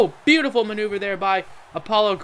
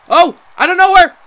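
much higher and louder, stretched slow call; excited shout; 7 semitones higher, 8 dB louder, slower than usual. Real, not acted. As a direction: yell it as the surprise finisher lands. Sudden Angle slam in match.

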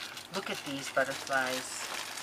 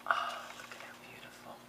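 hushed whisper of wonder; awed whisper; 11 dB quieter, faster than usual. Real, not acted. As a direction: breathe it in wonder. Admiring gift.

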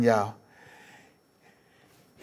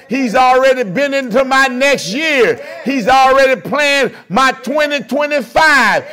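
higher, louder preaching that builds to a peak; preaching at full force; 6 semitones higher, 5 dB louder than usual. Acted. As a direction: drive it home, climbing to the last line.

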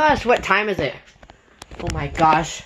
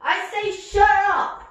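much higher, screaming shut up over it; shrill shout; 10 semitones higher than usual. Acted. User screaming at the speaker playing a song.

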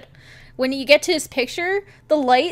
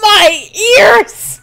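high, loud protest; pained shout; 9 semitones higher, 12 dB louder than usual. Real, not acted. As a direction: cry out at the deafening noise. Reacting to the deafening coo.

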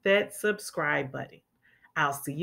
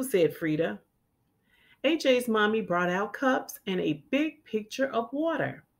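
clear, lively storybook reading; children's story read-aloud. A narrator's reading. Teacher reading a picture book to children, lively. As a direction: read it like storytime for little kids.

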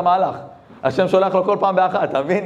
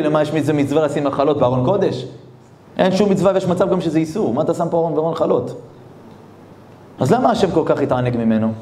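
usual speaking voice

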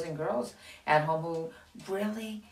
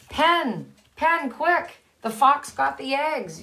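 high, loud urgent call; yelling Ben; 8 semitones higher, 5 dB louder, slower than usual. Acted. Ben yelling to Pen that the fox took the eggs, high and loud. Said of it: "yelled Ben".

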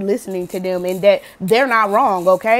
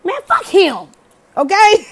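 much higher, scornful brush-off; dismissive; 10 semitones higher, 5 dB louder, slower than usual. Retold or imitated. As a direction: wave the guy off for your friend. Dismissing the ex for friend.

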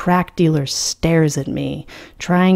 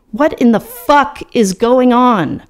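higher and louder, enraged; seething outburst at the waitress; 7 semitones higher, 4 dB louder than usual. A narrator's reading. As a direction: demand it through rising fury.

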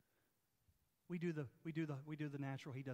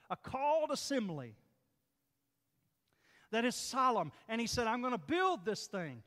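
raised, emphatic sermon voice; full-force preaching; 9 semitones higher, 11 dB louder than usual. Real, not acted. Preacher teaching the congregation about the called-out church, forceful and emphatic. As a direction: preach it out, punching the key words.

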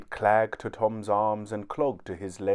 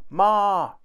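high, loud call for mother; urgent shout; 10 semitones higher, 7 dB louder than usual. A narrator's reading. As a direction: holler for Ma. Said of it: "shouted Tom".

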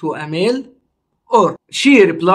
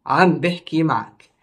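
عم بحكي معك run together at native speed; natural fast speed; 2 semitones lower, much faster than usual. Shown as a demonstration. Teacher repeating عم بحكي معك for students at native speed. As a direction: rattle off عم بحكي معك like a local. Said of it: "Our first sentence is".

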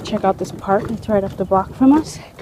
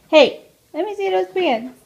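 high coaxing call; 10 semitones higher, 4 dB louder than usual. Real, not acted. Mom coaxing toddler to show pants, high-pitched call. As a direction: pitch it way up to coax a little one over. Calling toddler over once more.

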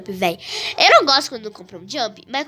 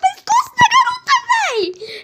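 laughing shout; 5 dB louder than usual. Real, not acted. Laughing at the girl's neck.

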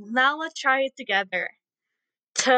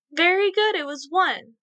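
bright praise; 7 semitones higher than usual. Real, not acted. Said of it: "praising children".

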